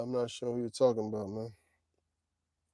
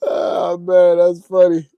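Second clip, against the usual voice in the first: loud, high, drawn-out amusement; amused after laughing; 5 semitones higher, 15 dB louder, slower than usual. Real, not acted. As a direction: sigh it out loudly after a big laugh. Recovering from a laughing fit.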